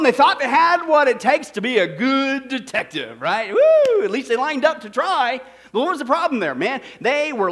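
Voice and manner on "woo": high, loud whoop; mock cheer. Preacher cheering hopeless trainees for trying, loud mock whoop.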